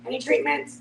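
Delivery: usual speaking voice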